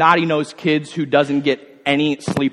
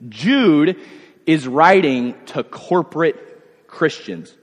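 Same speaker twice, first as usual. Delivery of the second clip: ordinary talk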